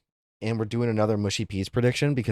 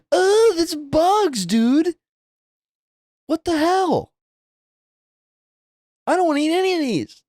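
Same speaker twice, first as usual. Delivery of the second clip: revolted outcry; 5 dB louder than usual. Real, not acted. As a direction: shout your disgust at the screen. Recoiling from food photos.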